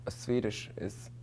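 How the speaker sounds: slow Swedish attempt that comes out German; badly faked Swedish accent; slow, German-sounding go at Swedish. Shown as a demonstration. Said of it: "that, that sounds German".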